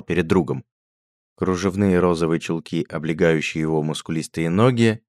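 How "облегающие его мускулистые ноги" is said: ordinary delivery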